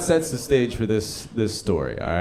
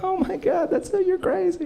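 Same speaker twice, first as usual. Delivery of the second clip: giggly coworker. Retold or imitated. Shantae laughing reply.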